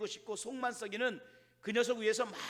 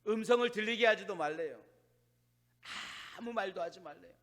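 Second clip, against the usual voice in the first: normal talking voice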